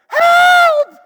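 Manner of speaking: high, loud shout for help; mock cry for help. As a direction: yell it as if overwhelmed. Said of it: "where you're like".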